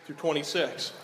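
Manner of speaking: ordinary talk, nothing marked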